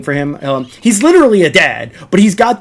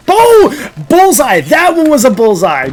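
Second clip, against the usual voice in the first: high, loud, gloating yell; triumphant shout; 9 semitones higher, 5 dB louder than usual. Real, not acted. Streamer landing an arrow on a friend, triumphant shout. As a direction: crow over the hit.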